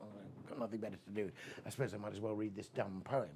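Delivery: fast, soft, mocking teenage boredom; bored teen scoff. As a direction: toss it off like a sulky teenager. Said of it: "I thought".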